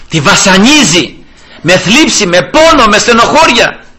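high, loud mounting sermon climax; fervent preaching. Acted. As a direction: build it to a cry. (κορύφωση κηρύγματος, δυνατά)

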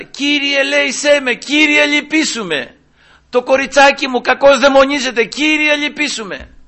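high, insistent plea for mercy; crying out. Retold or imitated. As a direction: call out after the Lord, pitched well up, as a desperate mother. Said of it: "Και φώναζε". (φωναχτή ικεσία, ψηλά)

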